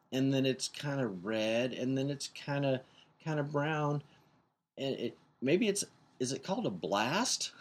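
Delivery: put-on high, slow, hesitant little girl voice; imitating his daughter. Dad imitating his daughter guessing the guitar color, high and slow. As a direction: feel your way through it like a puzzled kid.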